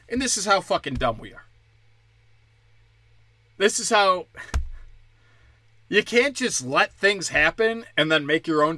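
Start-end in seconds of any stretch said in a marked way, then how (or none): none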